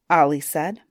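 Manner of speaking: plain reading voice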